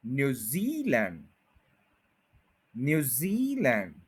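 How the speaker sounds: New Zealand slow, leaning on जी; slow New Zealand with जी stressed. Shown as a demonstration. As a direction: hit the जी in New Zealand. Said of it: "जी पे पूरा stress करें आप".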